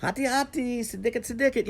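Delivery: high, choppy Indonesian phrases, lightly imitating the lad. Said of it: "has a really thick accent".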